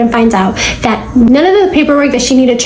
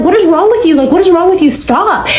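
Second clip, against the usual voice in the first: higher, exasperated cry of what is wrong with you; retold pleading outburst; 6 semitones higher than usual. Jordan begging her stepmom to stop attacking her, higher and exasperated. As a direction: push back, bewildered and upset.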